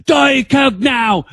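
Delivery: loud, slow, high demanding shout; commanding shout. Retold or imitated. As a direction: bark the order like a spoiled rock star. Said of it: "He's like".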